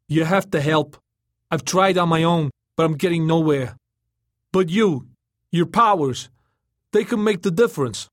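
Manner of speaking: high, loud, urgent appeal; the turtle, pleading. A narrator's reading. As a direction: implore him with rising urgency.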